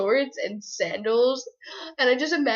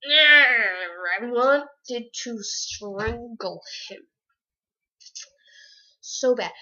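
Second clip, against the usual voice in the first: strained mock rage; 5 dB louder, much slower than usual. Real, not acted. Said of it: "that made me so mad".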